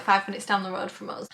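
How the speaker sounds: ordinary talk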